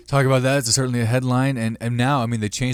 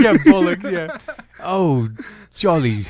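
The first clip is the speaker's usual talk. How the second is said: mock British accent; 7 semitones higher, 4 dB louder, slower than usual. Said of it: "British accent".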